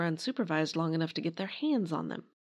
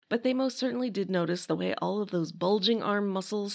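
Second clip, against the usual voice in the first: ordinary talk, nothing marked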